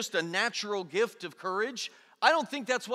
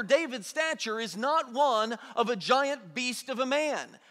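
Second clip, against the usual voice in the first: usual speaking voice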